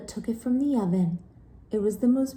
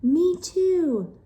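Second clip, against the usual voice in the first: high, loud screech; 9 semitones higher, 5 dB louder than usual. Acted. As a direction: screech it shrilly back. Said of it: "screeched Wilda".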